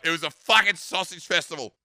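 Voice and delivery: usual speaking voice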